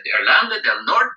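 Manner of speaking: Spanish reply. Retold or imitated. Answering policeman in Spanish.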